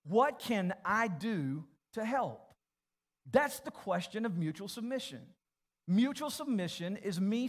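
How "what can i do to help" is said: slow, spelled out for writing. Shown as a demonstration. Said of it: "I want you to write this question down".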